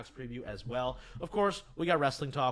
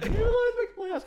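much higher and louder hopeful squeal; 10 dB louder than usual. Retold or imitated. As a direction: squeak it out full of hope. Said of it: "I'm over here being like".

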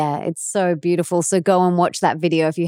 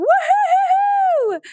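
joyful cheer; much slower than usual. Real, not acted. Cheering the news.

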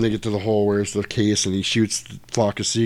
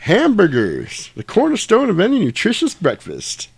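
imitating Jules; 10 semitones higher, 3 dB louder than usual. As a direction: savour the first word, then lift it high. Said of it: "he goes".

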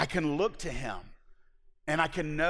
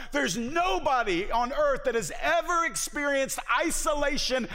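preaching at full force; 8 semitones higher than usual. Acted. Preacher to the congregation, building to a full-force peak. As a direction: pour it out from the pulpit.

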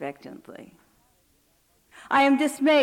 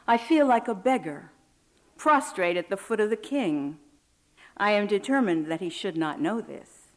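ordinary delivery

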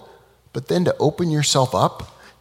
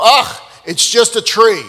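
slow, louder, higher "Ugh" of disdain; dismissive scoff; 7 semitones higher, 7 dB louder than usual. Acted. Students scoffing at the rabbi's floating tree, dismissive. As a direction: brush the miracle off as nothing.

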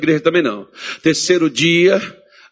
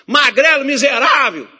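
much higher, louder snarling demon taunt; demon insulting; 11 semitones higher, 6 dB louder, slower than usual. Retold or imitated. Possessed person's demon taunting the skinny pastor, snarling. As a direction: spit the insult like a mocking devil. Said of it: "ele falava assim".

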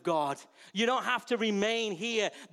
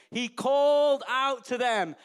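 calling out; 5 semitones higher, 7 dB louder, slower than usual. Acted. Jesus calling to the disciples.

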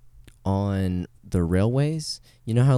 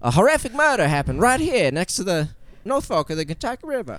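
high, dramatic tour guide narration; old-timey tour guide voice; 10 semitones higher than usual. Shown as a demonstration. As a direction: spook the tourists like an old guide. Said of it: "talk like the old-timey voice the whole time".

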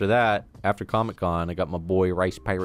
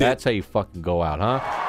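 ordinary talk, nothing marked

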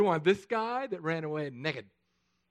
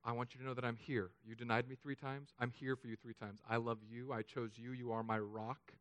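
much softer, gentle and reassuring; tender Jesus; 6 semitones lower, 11 dB quieter than usual. Acted. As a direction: speak it like a promise to a friend who failed. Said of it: "He wanted to say".

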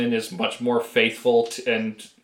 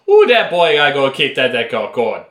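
heavy Cajun; 2 semitones higher, 6 dB louder than usual. Acted. Mimicking how Louisiana talks, heavy Cajun accent. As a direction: whoop it out in broad mock Cajun. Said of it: "with, like, the heavy Cajun".

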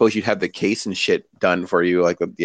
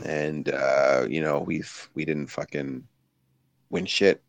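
low, sour grumble; bitter; 5 semitones lower, 8 dB quieter, slower than usual. Pretend. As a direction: mutter it like a sore loser. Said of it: "I should deliver it like I hate it".

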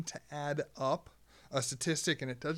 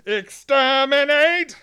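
booming arrogant alien overlord; 11 semitones higher, 15 dB louder, slower than usual. Acted. Mock pest control ad, Davros.

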